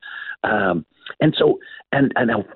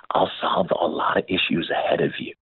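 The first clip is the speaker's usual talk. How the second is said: soft unvoiced whisper; voicing God softly; 6 semitones lower, 5 dB quieter than usual. Telling of God's promise to him, hushed whisper. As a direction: breathe it like a gentle secret promise. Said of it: "He says".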